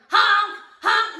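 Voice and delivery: loud, high honking horn noise; imitating car horn. Mimicking her car alarm going off. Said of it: "my alarm goes off".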